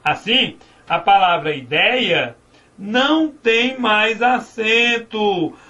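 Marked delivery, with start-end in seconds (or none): 2.8-5.5 "não tem mais acento" dragged out, hitting "não"; slow and stress "não". Shown as a demonstration. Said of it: "Por que que ideia não tem mais acento?".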